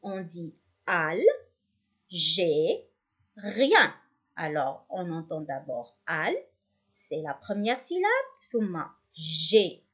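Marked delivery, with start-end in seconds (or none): 0.9-3.9 "al, gé, rien" with a clear pause after each syllable; each of "al, gé, rien" said separately; split into syllables. Shown as a demonstration. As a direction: break it up as al... gé... rien. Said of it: "pour le découper en syllabes".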